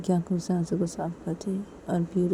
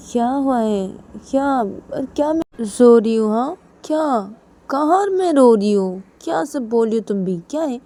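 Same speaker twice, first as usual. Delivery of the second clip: loud, higher-pitched protest; indignant, raised voice; irritated denial; 6 semitones higher, 7 dB louder than usual. Acted. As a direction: snap back as if wrongly accused. Ruhi snaps back, denying crying.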